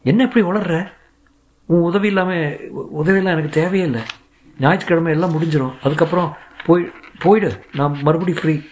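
incredulous and sharp, peaking on போயிடு; exasperated scolding. A narrator's reading. Ahalya scolds Sridhar.

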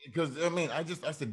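normal talking voice

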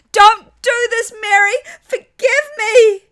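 high, loud pleading cry; desperate yell. A narrator's reading. Father screaming to his daughter in court, desperate cry. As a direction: scream for forgiveness at full force. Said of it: "Daddy yelled".

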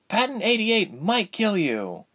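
high, slow worried character voice; timid warning. A narrator's reading. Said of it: "following him timidly".

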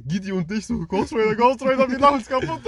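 high, loud, giggling delivery; cracking up at the memory; laughing while telling. Real, not acted. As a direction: squeal it out through real laughter. Cracks up recalling the friends laughing.

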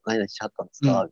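slipping Kansai. Retold or imitated. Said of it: "素で喋ったら出てまう".

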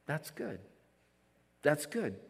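soft, spaced-out affirmation; quiet approval. Retold or imitated. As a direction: approve softly, letting each one land. Retells reassuring someone saying no.

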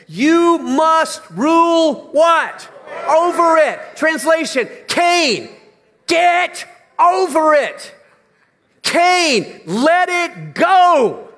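high, loud, slow pulpit cry; slow booming sermon cry; full-force preaching. Acted. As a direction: call Cain by name and command him. Preacher voicing God to Cain.

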